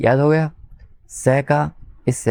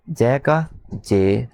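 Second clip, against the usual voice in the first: slow spelling; much slower than usual. Shown as a demonstration. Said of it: "जल को English में कैसे लिखेंगे?".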